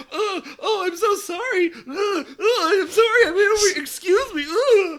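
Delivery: high, yelping, panicked mock apology; flustered apologizing boy. Mocking Bell as he trips over women, high and panicky. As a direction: play the shy hero stumbling into women, squeaking sorry. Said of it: "just be like".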